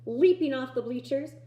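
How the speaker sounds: ordinary delivery, nothing marked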